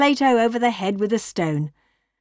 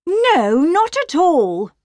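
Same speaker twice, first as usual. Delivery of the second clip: high, loud, slow telling-off; sharp high-pitched mother's reprimand; scolding; 9 semitones higher, 4 dB louder, slower than usual. A narrator's reading. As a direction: scold shrilly as Mother Wolf. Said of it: "scolded his mother".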